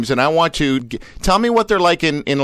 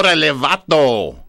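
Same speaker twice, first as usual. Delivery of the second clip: Mexican Spanish greeting, a little more put on; Mexican slang. Host repeating his only Spanish to the guest, Mexican accent. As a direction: lean into a Mexican Spanish "Órale, vato". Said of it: "that sounds Mexican".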